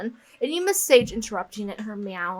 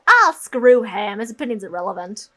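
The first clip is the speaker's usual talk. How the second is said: mock dismissive outburst; 7 dB louder than usual. Real, not acted. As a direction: throw it out loudly, dismissing him. Snaps back at Drake's disapproval.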